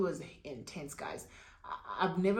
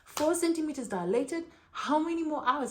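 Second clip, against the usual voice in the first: shocked disbelief; 8 semitones higher than usual. Retold or imitated. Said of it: "I'm like".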